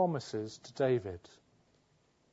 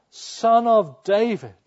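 loud, higher, slow desperate cry; crying out louder; 8 semitones higher, 12 dB louder, slower than usual. Retold or imitated. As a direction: beggar shouting even louder over the crowd. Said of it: "He cries out all the more".

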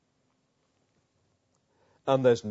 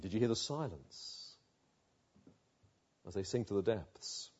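hushed; 6 semitones lower, 10 dB quieter than usual. Real, not acted. Preacher evoking silence after the pigs drown, hushed. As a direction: drop low and soft, with a long pause.

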